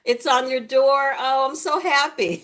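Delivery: high, loud and slow with delight; squealing happy outburst; overjoyed. Real, not acted. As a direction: cry out with real joy. Sees comment, gift on door.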